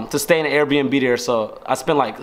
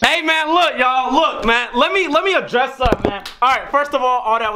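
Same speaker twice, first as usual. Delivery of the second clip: excited; 9 semitones higher than usual. Real, not acted. Hyped right after prank reveal.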